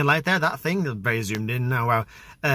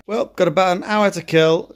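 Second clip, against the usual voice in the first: ordinary talk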